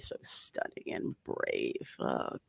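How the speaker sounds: breathy mock whisper, soft and slow; sarcastic whisper. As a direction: whisper it as a sneering aside.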